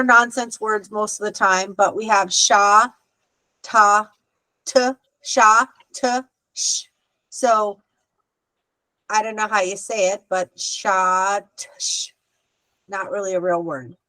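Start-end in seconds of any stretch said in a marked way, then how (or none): 2.3-6.8 "sha, ta, t, sha, t, sh" in separate pieces; sounding out. Shown as a demonstration. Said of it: "practice putting sounds together". 10.6-12.1 "sha, t, sh" sounded out piece by piece; blending sounds. Shown as a demonstration. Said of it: "practice putting sounds together".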